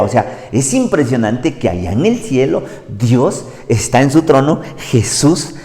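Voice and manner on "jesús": ordinary delivery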